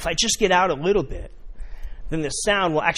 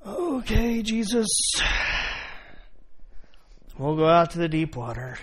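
reluctant; 3 semitones higher than usual. Acted.

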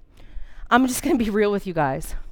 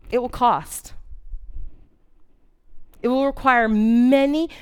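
ordinary talk

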